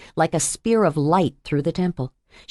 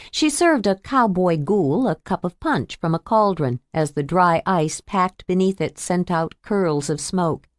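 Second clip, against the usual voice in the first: ordinary delivery, nothing marked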